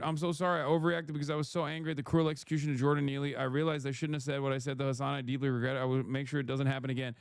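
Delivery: fast flat apology read; rapid readout. Retold or imitated. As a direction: rattle off the apology quickly. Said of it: "Unbanned, monitored".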